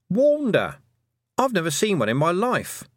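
urgent. Acted. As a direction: raise the alarm. Miss Tick stresses the danger.

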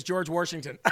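an r slipped into "Washington": "Warshington". Retold or imitated. Dad naming the first president, "Washington" as "Warshington". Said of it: "my dad says "warsh".

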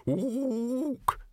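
high agreeable orangutan Ook; agreeing ape. Acted. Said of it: "the librarian agreed".

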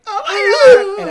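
high, loud, drawn-out cry of joy; overjoyed shout. Retold or imitated. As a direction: gasp it out overwhelmed. Emotional reaction to mom's thanks.

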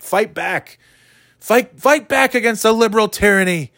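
high, rousing mock radio rant; mock talk radio host. Imitating radio host rallying listeners. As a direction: ham up a right-wing host calling to arms. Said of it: "They use the key words".

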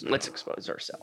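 breathy, low suggestive whisper. Pretend. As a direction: whisper it like a naughty innuendo. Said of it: "Not like that".

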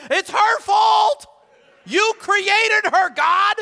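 high, loud, drawn-out tantrum; childish Adam. Acted. Adam blaming his wife to God, childish shouted whine. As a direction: wail the blame like a child. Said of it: "He started acting like a child".

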